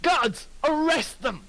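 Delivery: high, slow enraged command; furious order. Acted. Lucius ordering his guards, high furious shout. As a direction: scream the arrest order. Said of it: "He goes".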